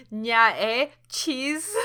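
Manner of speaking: laughing Toronto slang to a new friend; mock Toronto slang. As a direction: throw slang with a grin. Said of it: "I was just like".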